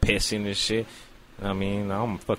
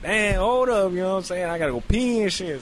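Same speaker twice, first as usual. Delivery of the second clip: urgent high voice; 10 semitones higher, 3 dB louder than usual. Retold or imitated. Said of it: "And I'm like".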